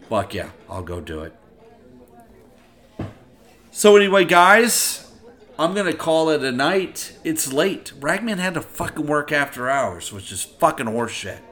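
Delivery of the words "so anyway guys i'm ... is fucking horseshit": loud rant. Real, not acted. Loud ranting sign-off.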